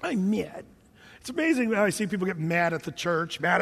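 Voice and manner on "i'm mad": pouty, put-on "mad"; big-baby sulk. Preacher playing offended churchgoers, babyish sulk. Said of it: "we're all just a bunch of big babies".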